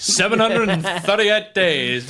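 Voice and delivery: slow, high, loud drawn-out proclamation; mock announcement. Co-host mocking how the title reign gets announced, grandiose mock proclamation. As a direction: stretch it out like a big title announcement. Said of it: "say it like that".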